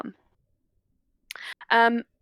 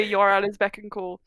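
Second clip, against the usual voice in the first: normal talking voice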